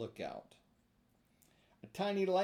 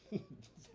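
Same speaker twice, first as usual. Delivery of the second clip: soft laugh; 5 semitones higher, 8 dB quieter than usual. Real, not acted. Narrator laughs.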